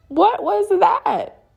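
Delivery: very high, drawn-out acted question; incredulous. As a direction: demand an explanation in exaggerated disbelief. Confronting partner about the sighting.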